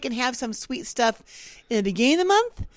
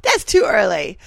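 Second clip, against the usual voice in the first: mock protest; 3 semitones higher, 7 dB louder than usual. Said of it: "And you're like".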